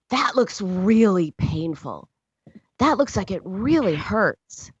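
slow, emphatic sympathy; acted motherly acknowledgment, drawn out; validating mother. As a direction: acknowledge a child's pain slowly and with weight. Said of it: "because I had acknowledged".

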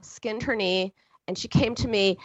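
normal talking voice

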